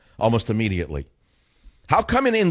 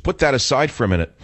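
usual speaking voice

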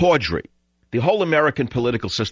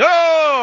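high mock audience whoop; mock applause whoop; 5 dB louder than usual. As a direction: whoop like a cheering crowd.

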